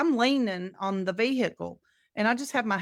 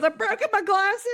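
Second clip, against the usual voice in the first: distressed friend; 10 semitones higher, 4 dB louder than usual. Retold or imitated. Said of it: "She goes".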